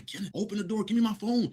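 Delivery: put-on high, pleading girlfriend at the door. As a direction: beg him through the locked door to open up. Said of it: "started banging on my door saying".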